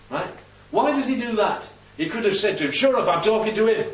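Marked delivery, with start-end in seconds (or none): 2.7-3.9 raised, snappy retort; mock rebuke. Prisoner's imagined retort to the Roman, mock rude rebuke. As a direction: cut him off rudely, play-acted.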